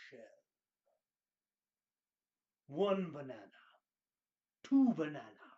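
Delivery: usual speaking voice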